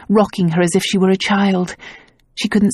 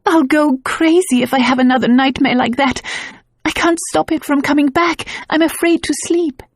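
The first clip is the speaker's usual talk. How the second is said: giggling sobs; 8 semitones higher than usual. A narrator's reading. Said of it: "erupted into giggling sobs".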